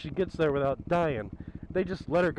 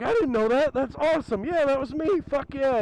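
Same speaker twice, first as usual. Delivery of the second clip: proud and pumped; thrilled; 8 semitones higher than usual. Retold or imitated. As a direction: friend bragging to the town cop about the chase. Friend thrilled it was her.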